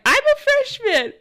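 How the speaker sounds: high, slow and sheepish; squeaky sheepish admission. Retold or imitated. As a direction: squeak it out like a shy kid. Answering colleague's question.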